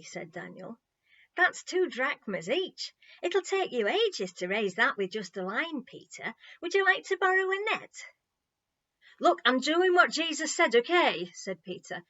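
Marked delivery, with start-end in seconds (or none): 1.4-7.9 higher, louder mock-helpful offer; cheeky teasing. A narrator's reading. Daniel teasing Peter about his fishing line. 9.2-11.3 loud, higher exasperated snap; annoyed. A narrator's reading. Peter snapping at the mocking crowd.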